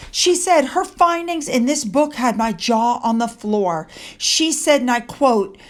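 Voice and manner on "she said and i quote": normal talking voice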